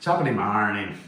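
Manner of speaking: hammed-up stage Irish greeting; playful, over-the-top Irish brogue; Irish phrase. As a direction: put on a mock Irish lilt, loud at the start. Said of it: "That's about as much Irish I can go".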